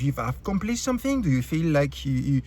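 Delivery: ordinary delivery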